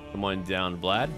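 normal talking voice

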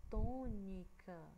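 tônica drawn out very slowly, syllable by syllable; slowly spelled out syllables. Shown as a demonstration. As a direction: stretch tônica out so each syllable stands apart. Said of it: "e a palavra".

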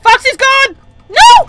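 high, loud panicked shriek; screaming no; terrified scream. Real, not acted. Panic as Foxy runs.

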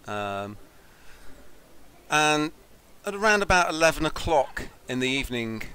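measured storytelling voice; game master narration. Acted. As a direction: set the scene for the players. GM sets the scene.

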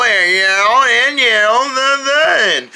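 very slow, drawn-out mock girl; imitated girl talk. Showing how girls talk, slow exaggerated imitation. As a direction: stretch each word out absurdly. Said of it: "girls can't be talking like that".

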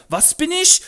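sehr hoch, laut, prollig; Straßengöre. Acted. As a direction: patzige Göre im Jugendslang. Eliza empört. (hohe, laute Prollstimme)

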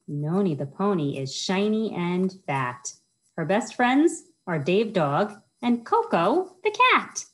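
lilting rhyme-reading voice, bouncing on each rhyme; reading to children. Acted. Children's librarian reads a rhyming book to kids, sing-song storytime voice. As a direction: read it like storytime for little kids.

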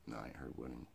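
ordinary delivery

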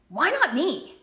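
"Why not me?" in a put-on bitter voice; resentful why not me. Shown as a demonstration. Coach voicing the jealous, bitter version. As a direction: sneer it with jealousy.

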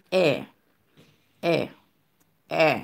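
E repeated alone as an open è; open vowel. Shown as a demonstration. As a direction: model E as è, one at a time. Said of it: "ou di E". (E tankou è, youn apre lòt)

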